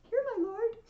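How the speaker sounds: acted high-voiced response; dutiful wife answering. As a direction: pipe up high in reply to your lord. Calpurnia answers Caesar's call.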